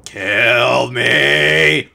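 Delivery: loud hammed-up plea to die; mock despair. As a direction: throw it out like a melodramatic groan. Mock despair at game task.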